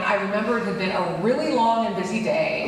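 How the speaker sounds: ordinary talk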